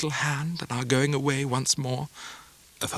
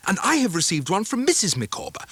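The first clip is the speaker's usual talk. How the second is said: a touch higher, lightly voiced surprise; lightly surprised, leaning in; surprised Traddles; 7 semitones higher than usual. A narrator's reading. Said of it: "cried Traddles".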